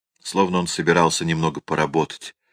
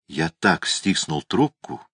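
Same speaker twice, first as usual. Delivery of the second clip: plain reading voice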